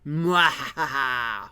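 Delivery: loud slow evil laugh; evil villain cackle. Acted. Villain laugh after blurb.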